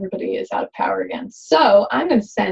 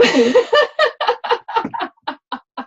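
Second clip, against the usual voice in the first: high bursting laugh; delighted high laugh; 13 semitones higher, 4 dB louder than usual. Real, not acted. Laughs after gobbling.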